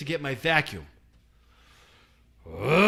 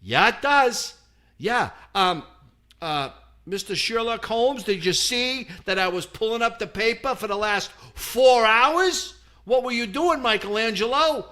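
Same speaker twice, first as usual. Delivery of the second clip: loud, high mocking comeback; sarcastic retort; 7 semitones higher, 5 dB louder than usual. Telling off the lazy painter, loud and sarcastic. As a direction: tear into him with sarcasm. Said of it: "I'm like".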